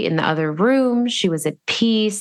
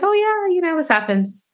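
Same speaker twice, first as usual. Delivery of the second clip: rabbi's casual reply; 11 semitones higher than usual. Retold or imitated. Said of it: "He's like".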